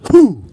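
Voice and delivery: loud sharp exhale through a snorkel; snorkel blast. Shown as a demonstration. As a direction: blow hard to clear the tube. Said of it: "And blast again".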